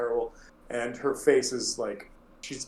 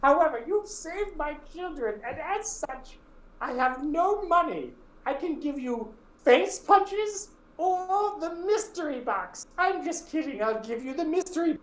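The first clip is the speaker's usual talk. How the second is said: Hilda's terrible voice. Acted. Hilda introducing herself to the adventurers, high terrible voice. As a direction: drag it out like a gnarled old widow.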